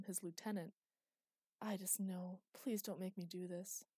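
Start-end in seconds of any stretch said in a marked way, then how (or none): none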